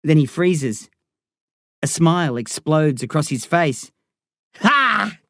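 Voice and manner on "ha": hollered laugh. Acted. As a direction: burst out with a triumphant "Ha!". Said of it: "he hollers".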